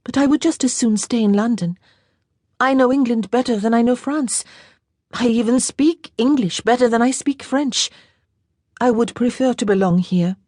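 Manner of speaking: a lightly voiced young lady, wistful; young French girl. A narrator's reading. Girl's voice held by narrator.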